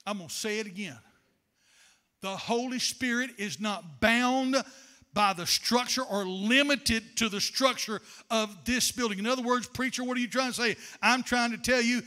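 normal talking voice

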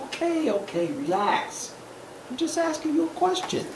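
soft, backing-off voice; placating duck. Acted. Duck soothing the annoyed pigeon, placating.

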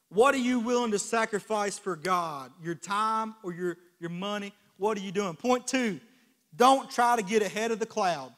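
rising sermon climax, loud and slow; building preaching climax. Acted. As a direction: build the praise to a shout. Preaching at full force.

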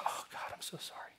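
hushed, contrite whisper; whispered apology. Retold or imitated. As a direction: whisper it like a humbled confession. Retold apology to God.

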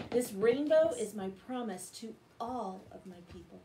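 ordinary delivery

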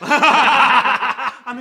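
laughing. Real, not acted. Joke said laughing.

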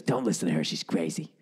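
low, gruff mom dismissing the tale; mother's rough voice. Retold or imitated. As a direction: growl it like a tired chain-smoking mom. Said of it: "She goes".

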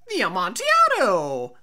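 loud, stunned cry of the wine's name; sudden loud cry. A narrator's reading. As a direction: burst out in shock. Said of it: "ejaculated my friend".

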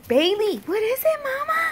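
high cooing to the puppy. Real, not acted. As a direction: coo at the puppy.

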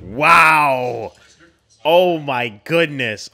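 loud sudden wow then slow gasp; shocked. Real, not acted. Viewer sees cop walk in, loud shocked gasp. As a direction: caught off guard and reeling.

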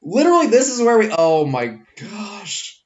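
exasperated. Real, not acted. Game crashed, exasperated.

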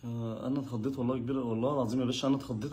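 soft meek apology; sheepish. Acted. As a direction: apologize meekly after the fright. Groom apologizes after scare.

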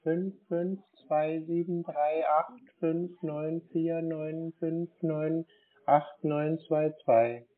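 slow dictation. Real, not acted. Dictating card number slowly.

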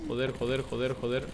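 soft, low repeated swearing; cursing under breath. Real, not acted. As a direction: mutter the curses to yourself. Muttered after being hit.

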